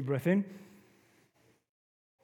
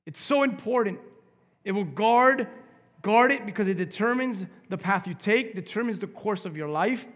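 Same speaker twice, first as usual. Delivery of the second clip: forceful preaching; 5 semitones higher, 7 dB louder than usual. Acted.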